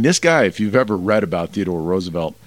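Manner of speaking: ordinary talk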